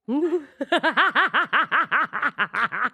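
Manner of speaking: long high laugh. Real, not acted. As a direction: burst out laughing. Laughs at pick me joke.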